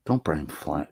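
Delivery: usual speaking voice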